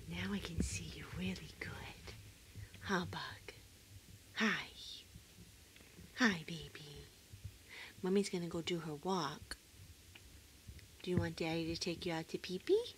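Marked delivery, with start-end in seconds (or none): 0.0-7.0 whispered pet talk; hushed loving whisper. Real, not acted. Cooing to sleepy pet.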